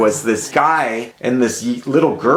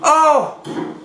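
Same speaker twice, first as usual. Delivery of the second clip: shocked cry. Real, not acted. Shocked by gory film scene.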